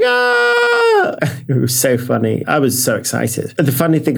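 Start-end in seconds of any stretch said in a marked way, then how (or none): 0.0-0.6 sudden excited yelp; excited squeal. Retold or imitated. Excited reaction to job offer email. 0.6-1.4 high giggle. Real, not acted. Laughing after retold squeal.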